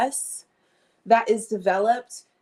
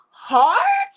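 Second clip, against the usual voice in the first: sudden high shout on hard; loud yell; 7 dB louder than usual. Real, not acted. Vlogger yelling about working since seven, loud. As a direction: belt the word hard out.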